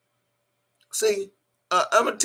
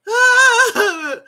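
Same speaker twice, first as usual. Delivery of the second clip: big high cackling laugh, much louder; 9 dB louder than usual. Real, not acted. Cackling loud at the hater's lamp complaint. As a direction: let a huge cackle burst out.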